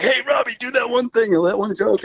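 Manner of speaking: high, playful mock request; imitating Scott. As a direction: lightly voice a buddy egging him on.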